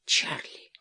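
hushed, whispered gasp of horror; horrified. A narrator's reading. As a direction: breathe the name out in shock. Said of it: "закричала Джоан в ужасе".

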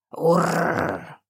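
short throaty growl. A narrator's reading. A creature growls outside the door, growling. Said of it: "послышалось за дверью".

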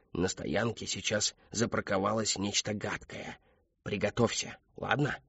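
hushed, measured warning; whispered warning. A narrator's reading. Robot cat warns Eddie of danger outside, whispering. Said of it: "зашептал Плохой Кот".

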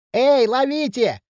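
high, drawn-out shout; high-pitched, stretched warning cry; anxious call. A narrator's reading. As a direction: call out slowly over a distance, alarmed. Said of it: "тревожный голос хряща".

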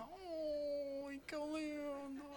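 high, soft, slow gushing; mock cooing. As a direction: gush over the photo in a cutesy voice. Mock cooing at dog photo.

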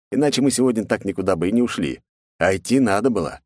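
plain reading voice

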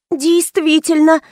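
ordinary delivery

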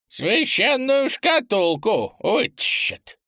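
put-on high, slow old man grumbling. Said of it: "проворчал чудак".

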